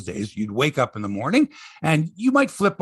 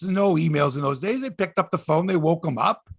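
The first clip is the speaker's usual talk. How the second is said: ordinary delivery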